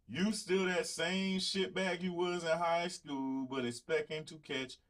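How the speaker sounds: exaggerated Southern accent, slowed right down; Southern twang. Shown as a demonstration. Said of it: "That Southern twang".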